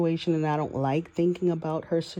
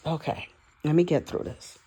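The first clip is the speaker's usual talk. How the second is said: normal talking voice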